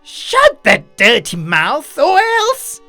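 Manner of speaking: slow, loud, high-pitched threat; angry snarl. A narrator's reading. Enraged man warns the other before a fight, snarling. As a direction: threaten him, rising to a peak. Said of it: "snarled at Mister Hong".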